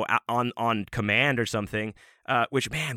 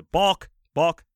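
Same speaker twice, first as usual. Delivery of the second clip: raised, louder one-word call; umpire's call; 7 semitones higher, 7 dB louder than usual. Retold or imitated. Umpire calls out a foul.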